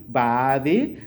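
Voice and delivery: "baadhi": ba-a-dhi, a th sound at the end; model baadhi. Shown as a demonstration. Teacher showing a student how to say it, careful. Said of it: "take note of the third syllable".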